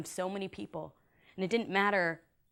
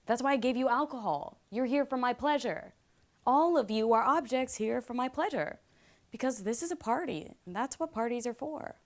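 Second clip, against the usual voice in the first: put-on higher-pitched, insistent frat-boy lecture; entitled frat host; 3 semitones higher than usual. Frat host telling guests they are here for his pleasure, entitled. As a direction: press the entitlement on every phrase.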